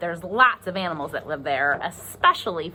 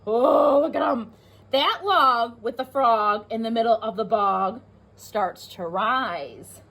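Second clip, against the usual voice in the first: usual speaking voice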